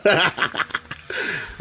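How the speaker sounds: amused, breathy burst of laughter; helpless little fit of laughter; real laugh. Real, not acted. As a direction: laugh at your own joke. Laughing at own accent joke.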